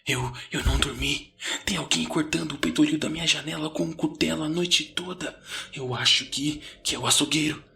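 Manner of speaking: a hint of whispered panic; panicked murmur. A narrator's reading. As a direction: narrate a frightened friend, voice held back. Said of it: "recebi um sussurro de pânico".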